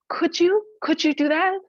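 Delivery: hopeful disbelief. Acted. Sky Woman answering the turtle, high eager whisper. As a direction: gasp with sudden hope.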